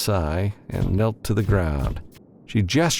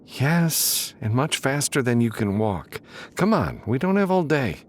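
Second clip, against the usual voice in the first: higher, amused insistence; Sharon, teasing; 7 semitones higher than usual. A narrator's reading. Sharon insists on carrying Matthew, teasing and higher. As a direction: offer it with a grin in the voice.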